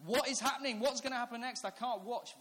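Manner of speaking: high, loud, frantic questions; confused panic. Retold or imitated. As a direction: imitate a kid who can't bear the suspense. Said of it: "the bits of confusion".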